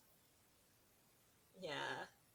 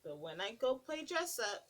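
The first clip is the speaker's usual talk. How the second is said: put-on lilting read-aloud to little kids; sing-song picture book narration; storybook reading; 3 semitones higher than usual. Reading a picture book to children, lilting.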